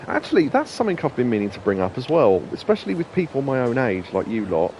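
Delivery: normal talking voice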